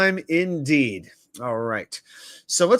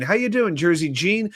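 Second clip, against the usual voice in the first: ordinary talk, nothing marked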